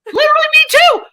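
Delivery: high, loud squeal of agreement; excited agreement. Real, not acted. Shared anxiety outburst.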